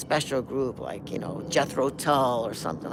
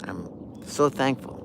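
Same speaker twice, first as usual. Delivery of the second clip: moved; 4 semitones lower, 4 dB quieter than usual. Real, not acted. Moved remembering the piece.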